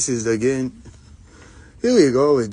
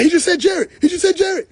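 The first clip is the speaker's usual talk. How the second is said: much higher and louder, disbelieving "He just said Jerry"; high mock outrage; 5 dB louder than usual. [name] outraged that the friend called him Jerry, high mock squeal.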